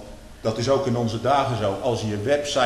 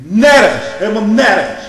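louder, rising to a shout on nergens; indignant outcry; 8 semitones higher, 13 dB louder than usual. Real, not acted. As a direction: hammer home the outrage. Preacher protests indignantly.

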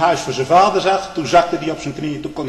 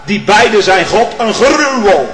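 fiery preaching; 8 semitones higher, 10 dB louder than usual. Acted. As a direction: preach it like a judgement. Preacher at full force.

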